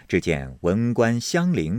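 ordinary delivery

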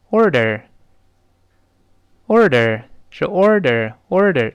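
"order" slowly, r in each syllable; both R's curled. Shown as a demonstration. Teacher rereading the word for students, both R's curled. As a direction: model "order" with a curled tongue twice over. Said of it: "前后都有这个卷舌音。我们再读一下".

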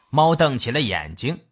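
usual speaking voice

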